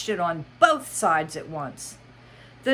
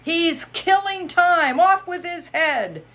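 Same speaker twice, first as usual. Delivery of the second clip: loud, high angry yell; the Queen yelling at the Hatter, loud and high; 13 semitones higher, 8 dB louder than usual. Acted. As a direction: bellow the order. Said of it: "jumped up and yelled".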